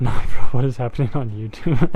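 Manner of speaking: laughing through the words; cracking up mid-question. Real, not acted. Laughs at a YouTube chat message.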